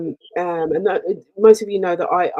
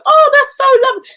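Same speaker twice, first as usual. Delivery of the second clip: much higher and louder, thrilled squeal; gushing delight; 8 dB louder than usual. Retold or imitated. Replying to a fan who greets her, thrilled high gush. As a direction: gush like you just met a fan.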